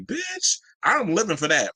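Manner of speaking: high-pitched, gushing mock reaction; playful high-pitched rave; thrilled reaction. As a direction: squeal the first word, then gush. Acted thrilled reaction to stage design.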